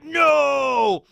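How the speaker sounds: high, loud mock outraged "No!"; angry villain cry. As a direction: yell it in comic fury. Artur pissed about treasure.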